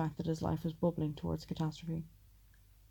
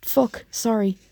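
loud, higher blurted apology; 5 semitones higher, 10 dB louder, slower than usual. A narrator's reading. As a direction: blurt it loudly. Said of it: "His loud voice".

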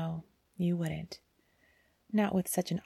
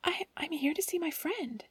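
trembling; 8 semitones higher than usual. A narrator's reading. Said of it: "her voice a tremble".

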